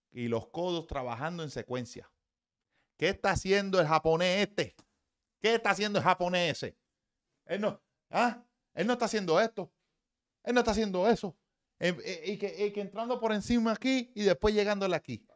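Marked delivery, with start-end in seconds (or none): 3.0-6.8 higher and louder, pressing the question; excited challenge. Real, not acted. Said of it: "excited repeated question, high and loud". 7.4-15.3 higher and louder, pressing the question; excited challenge. Real, not acted. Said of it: "excited explanation continues".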